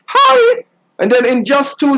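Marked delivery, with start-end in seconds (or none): 0.1-0.6 very high, drawn-out fake-friendly hi; simpering greeting. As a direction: gush a phony hello in a squeaky pitch. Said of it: "it's called simpering".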